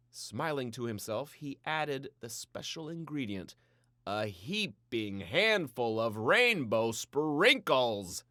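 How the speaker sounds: plain reading voice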